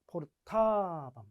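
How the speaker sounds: 포르타밤 drawn out slowly, syllable by syllable; slow spelled-out drill. Shown as a demonstration. Teacher drills 포르타밤 slowly for students. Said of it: "각 인칭과 수에 따라 엄이 나오고요".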